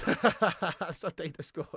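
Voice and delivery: slow, breaking up with laughter; words stretched out by laughing. Real, not acted. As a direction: say it through laughter. Cracks up repeating own phrase.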